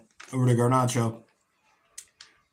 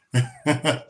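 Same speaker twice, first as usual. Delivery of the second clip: short laugh. Real, not acted. Laugh after joke.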